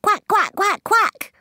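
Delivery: high, loud duck quacks; mummy duck quacking. A narrator's reading. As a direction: quack like a mother duck calling her young. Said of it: "took a deep breath and said".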